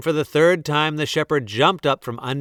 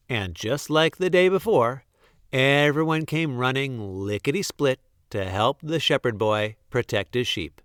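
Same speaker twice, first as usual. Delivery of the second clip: usual speaking voice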